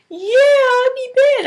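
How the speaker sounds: put-on slow, high little-girl voice; little daughter. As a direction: imitate a small child owning up. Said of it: "she's a little baby now".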